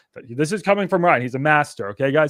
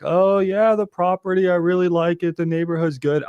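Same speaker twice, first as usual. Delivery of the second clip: lightly voiced friendly buyer, pitched higher; breezy small-talk caller gushing a bit; a hint of an over-friendly buyer who wants to chat; chatty buyer; 4 semitones higher than usual. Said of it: "like, wanting to chat to me".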